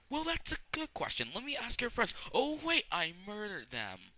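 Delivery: high, loud cartoonish outburst; mocking villain. Villain taunting about the murdered friends, high and loud. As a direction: exclaim it in a loud, high put-on voice. Acts out villain's spoken aside.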